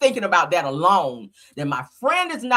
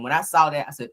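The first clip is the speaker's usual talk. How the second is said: usual speaking voice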